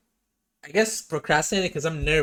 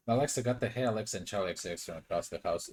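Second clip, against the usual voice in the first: ordinary talk, nothing marked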